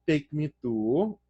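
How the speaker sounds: slow, eerily calm demand; calm and chill as if nothing is wrong; a mechanical, unhurried take me to her home. Retold or imitated. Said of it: "And he's being very calm".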